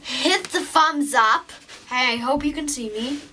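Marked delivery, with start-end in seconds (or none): none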